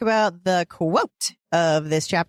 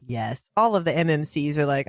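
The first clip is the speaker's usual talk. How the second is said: ordinary delivery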